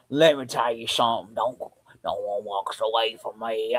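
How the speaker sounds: slow, drawn-out mock Southern drawl; fake Southern accent. Mocking the villain's accent to friends, fake Southern drawl. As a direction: imitate a bad Southern accent, dragging the words. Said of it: "That is a better accent than this one".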